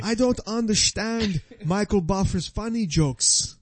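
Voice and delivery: put-on high Brazilian-accented fighter; mock Brazilian accent. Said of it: "and they talk like this".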